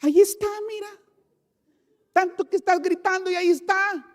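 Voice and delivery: high, sharp scolding mother; mock exasperated mother. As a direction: scold the son like a fed-up mom. (madre exasperada, voz aguda y regañona)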